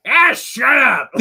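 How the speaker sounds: loud, high, drawn-out mock shout; imitated loud outburst. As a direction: break character with an exasperated holler. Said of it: "loud, deep voice".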